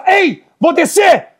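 high shouted warning to the dogs. Retold or imitated. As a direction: bark it over the fence as a threat. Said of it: "eu fiz assim".

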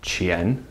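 QIAN low and soft, rising; rising pitch on QIAN; second tone. Shown as a demonstration. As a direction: lift QIAN from low like a question. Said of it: "Second tone though".

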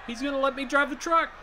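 high, quick, excited burst; thrilled. Real, not acted. Fan told he may drive the truck, thrilled. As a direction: blurt it out with delight.

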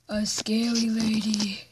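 hushed, slow scary whisper; creepy whispered intro; spooky whisper. Pretend. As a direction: breathe it out like a ghost lady. Face filter: scary lady.

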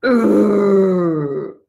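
loud frustrated growl; mock angry urgh. Shown as a demonstration. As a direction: growl like a grumpy kid. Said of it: "How about angry?".

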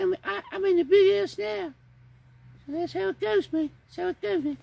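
very high squeaky puppet voice in fake French; high puppet babbling mock French. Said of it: "Timmy is really the one from England".

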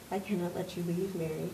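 soft, low, near-whispered instruction; calm facility voice. Acted. The building's voice tells Mary to return home, soft and low. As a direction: quietly insist she go home.

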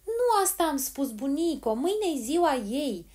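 high, loud shout, slowing at the end; insistent, high-pitched yell. A narrator's reading. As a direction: shout it out for a deaf granny. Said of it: "și striga atât de tare".

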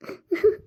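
high-pitched sob; sobbing. Acted. Worried mother hears her son is missing, sobbing. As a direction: break into a short sob. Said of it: "ಅಳ್ಬೇಡ, ಸುಮ್ನಿರು".

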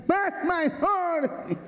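high, soft mock nasal whimper; mock nasal cry. Nasal girlfriend squealing at each slap, high and soft. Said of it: "era fanhosa".

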